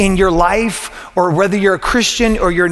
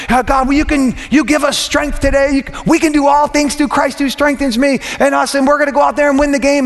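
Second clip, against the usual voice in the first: locker room prayer; 6 semitones higher than usual. Retold or imitated. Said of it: "I'm like".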